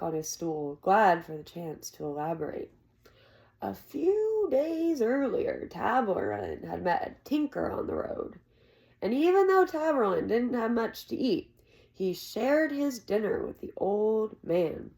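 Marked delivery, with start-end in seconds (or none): 3.6-14.9 lightly voiced old village storyteller, spinning a tale; old storyteller Cobb. A narrator's reading. Old Cobb telling the boy a legend, lightly voiced storyteller. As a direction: lean into the legend like an old man at the inn.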